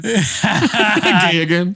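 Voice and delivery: very slow laughing slogan. Retold or imitated. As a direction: stretch it out through laughter.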